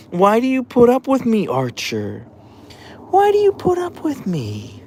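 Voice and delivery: high, tender cooing. Real, not acted. Owner coos fondly at her dog Archer. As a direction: coo up high, fond and grateful.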